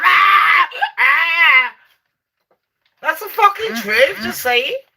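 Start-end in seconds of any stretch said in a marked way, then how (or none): 0.0-1.7 loud mock cries of pain; pained yells. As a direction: yelp as if you just hit the ground. Imagining smashing teeth out falling.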